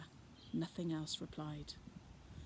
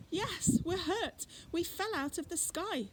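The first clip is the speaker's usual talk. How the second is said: put-on child's voice; 11 semitones higher than usual. Girl telling Fred they fell from the sky, high child voice. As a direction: answer like a hurt little girl. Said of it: "said one bush".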